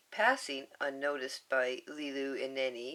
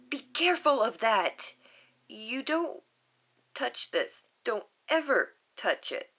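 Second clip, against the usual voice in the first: put-on higher, louder warning child voice; stern little girl; 5 semitones higher, 5 dB louder than usual. Lilu warning Stitch off her photo, higher child voice. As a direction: warn sharply like a protective girl.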